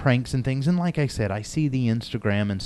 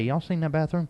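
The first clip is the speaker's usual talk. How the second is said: put-on Southern accent; 5 semitones higher, 4 dB quieter than usual. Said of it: "I adopt my Southern accent".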